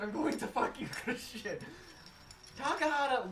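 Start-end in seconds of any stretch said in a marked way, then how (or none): 0.0-1.6 higher-pitched imitated Irish accent; mock Irish accent. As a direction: play the priest in a put-on Irish accent. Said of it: "talking in his Irish accent".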